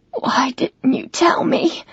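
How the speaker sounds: choked, slow whisper holding back tears; hoarse whisper. Acted. Woman confronting River in the bathroom, hoarse slow whisper. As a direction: whisper it slowly, fighting off crying. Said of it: "I hoarsely whisper".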